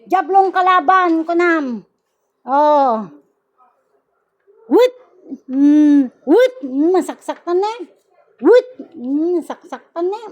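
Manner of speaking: loud, commanding rebuke of a spirit; healer's stern banishing chant; forceful exorcism commands. Acted. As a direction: drive the demon out with each word. Casts out evil spirit, commanding.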